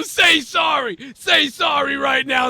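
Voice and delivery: high shouted "say sorry"; retelling the street guy's demand, shouted high; mock yell. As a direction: bark the demand like the guy in the clip. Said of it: "that dude go".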